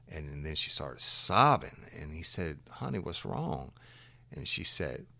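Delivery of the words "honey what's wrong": soft, a little higher, caring; a lightly voiced man comforting a crying woman; gently consoling. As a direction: ask it tenderly, like soothing someone in tears.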